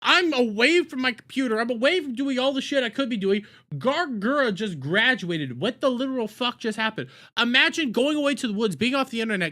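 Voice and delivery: ordinary talk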